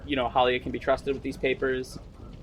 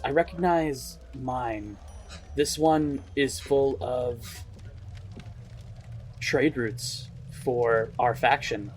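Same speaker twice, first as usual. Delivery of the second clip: slow, considered reading; 4 dB quieter, slower than usual. Acted. Game master voices Malik reading scrolls.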